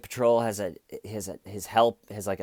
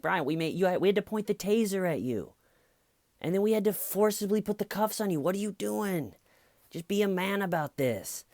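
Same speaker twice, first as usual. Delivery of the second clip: much higher, incredulous scolding; exasperated cop; 10 semitones higher than usual. Retold or imitated. Police scolding the cuffed drunk driver, incredulous. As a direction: scold him in disbelief. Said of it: "And they're like".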